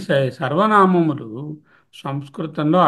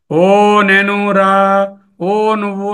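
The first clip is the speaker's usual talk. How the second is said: loud, drawn-out, higher-pitched call; calling from afar; 4 semitones higher, 6 dB louder, much slower than usual. Shown as a demonstration. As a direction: call out as if to someone far away, stretching the vowels. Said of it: "దూరంగా ఉన్న వాటిని సంబోధించి పిలిచినప్పుడు". (దూరంగా ఉన్నవారిని గట్టిగా సాగదీసి పిలుస్తున్నట్టు)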